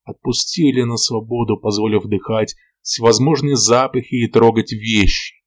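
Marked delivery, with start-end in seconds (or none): none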